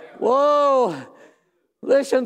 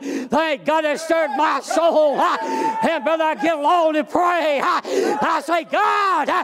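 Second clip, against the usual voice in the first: raised, shouting preacher cadence; preaching at full force; 4 semitones higher than usual. Acted. Preacher rousing his church, shouting with fervor. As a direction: preach it hard to the congregation.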